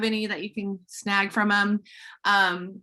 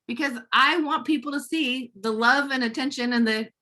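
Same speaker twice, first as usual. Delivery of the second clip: normal talking voice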